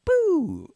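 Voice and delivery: high-pitched "boo" zap; imitated sci-fi blaster. Teacher likening his noise to Star Wars, high zap. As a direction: fire a toy laser with your voice. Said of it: "Star Wars, like".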